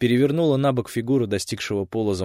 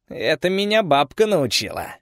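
higher proud reply; 8 semitones higher, 4 dB louder than usual. Acted. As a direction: boast a little. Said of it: "не без гордости ответила".

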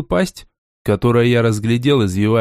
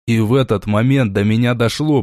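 ordinary delivery, nothing marked

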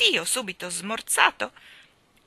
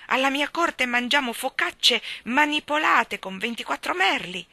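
loud, raised-pitch tirade of a boastful king; furious; 5 semitones higher, 6 dB louder than usual. A narrator's reading. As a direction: rant indignantly, pride stung. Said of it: "si infuriò il re". (sfuriata del re, a voce alta)

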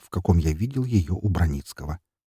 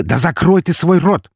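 loud, high exasperated order; angry bark; 10 semitones higher, 7 dB louder than usual. A narrator's reading. Narrator shuts Эри up, barking in exasperation. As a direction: bark to cut her off. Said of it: "рявкнул я".

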